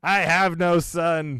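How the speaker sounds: slow, loud mock disowning; disowning dad. Host plays guest's sports-fan dad disowning him, mock outrage. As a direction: declare it like an outraged dad, hammed-up. Acts out the disappointed yelling dad.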